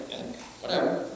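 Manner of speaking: breathy, dismissive near-whisper; dismissive shrug. Retold or imitated. Bernice brushing off what she heard, dismissive near-whisper. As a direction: brush it off under your breath. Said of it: "she's kinda like".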